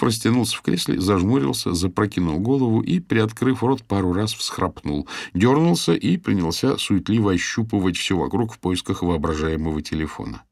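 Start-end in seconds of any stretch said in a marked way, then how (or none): none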